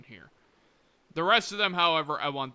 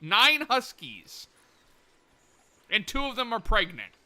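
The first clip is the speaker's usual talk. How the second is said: excited; 6 semitones higher, 5 dB louder than usual. Real, not acted. Counting the huskies in awe.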